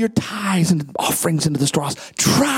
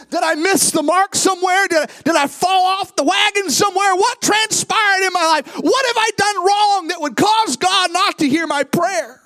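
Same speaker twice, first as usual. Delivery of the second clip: preaching at full force; 11 semitones higher than usual. Acted. Pastor voicing a doubting believer to the church, building to a shout. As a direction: build the questions into a cry.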